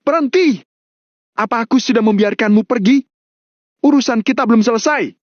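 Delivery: high-pitched angry outburst at normal volume; character yelling in anger, high voice; angry shout. A narrator's reading. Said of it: "bentak Mario".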